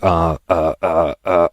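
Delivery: caught-out string of uhs; imitated stammering. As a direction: mimic someone lost for an answer. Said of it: "And he was like".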